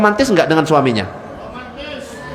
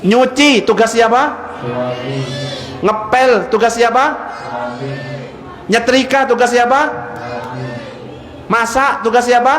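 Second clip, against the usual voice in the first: calling out questions; 4 semitones higher than usual. Real, not acted. Quizzing the congregation.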